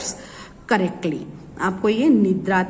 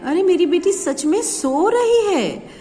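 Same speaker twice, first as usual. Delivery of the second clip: more expressive; 8 semitones higher than usual. Shown as a demonstration. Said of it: "हाव भाव change है".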